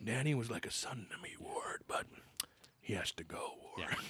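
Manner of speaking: hushed, menacing villain whisper. Retold or imitated. Villain whispering a threat to Ward, hushed.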